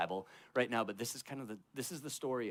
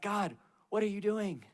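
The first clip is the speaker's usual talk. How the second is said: 1 anguished cry to God; 9 semitones higher, 6 dB louder than usual. Retold or imitated. Job's anguished outburst at God.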